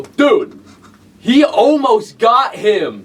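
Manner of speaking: high, drawn-out excited exclamation; re-enacted viewer's cry at a near miss; retold outburst. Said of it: "couldn't help myself but go".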